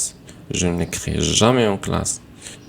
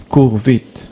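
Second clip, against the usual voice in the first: bright classroom command; 4 semitones higher, 6 dB louder than usual. Shown as a demonstration. Teacher reading imperative examples.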